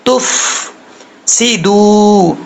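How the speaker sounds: careful Quran-teacher articulation of تفسدو; drawn-out tajweed demo. Shown as a demonstration. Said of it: "تفسدو نہیں پڑھنا".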